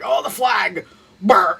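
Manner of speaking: high, loud mock fury at the flag; mock outrage. Mocking the group's flag outrage, mock angry brr. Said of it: "they're like".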